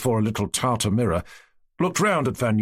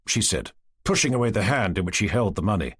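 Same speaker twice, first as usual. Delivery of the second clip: ordinary delivery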